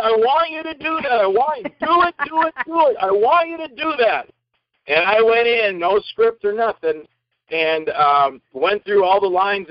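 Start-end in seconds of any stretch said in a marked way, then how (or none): 0.0-4.3 much higher, driving demand; booming actor. Shown as a demonstration. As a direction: push it like raising the roof. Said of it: "All of Saint Petersburg is gonna hear me".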